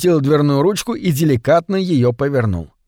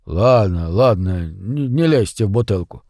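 frightened; 5 semitones lower than usual. A narrator's reading. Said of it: "испуганно отозвался прохожий".

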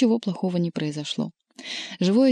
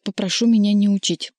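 louder, offended cat; huffy rebuff; 3 semitones higher, 6 dB louder than usual. A narrator's reading. Cat rebuffing a jibe at the table, huffily. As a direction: snub the advice with raised volume.

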